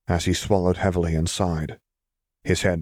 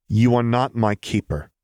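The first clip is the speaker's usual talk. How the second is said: higher-pitched defiant retort; 5 semitones higher, 4 dB louder than usual. A narrator's reading. Nephew standing up to his uncle, defiant. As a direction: push back at an elder, voice raised in pitch.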